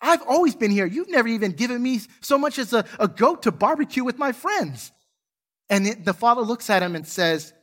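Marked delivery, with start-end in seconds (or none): 0.1-4.9 higher, louder indignant outburst; angry protest. Retold or imitated. Elder brother shouting at his father, indignant. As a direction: let the resentment spill out.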